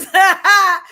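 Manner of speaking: sudden loud burst of laughter. Real, not acted. Woman cracking up at her own crude remark, loud laugh.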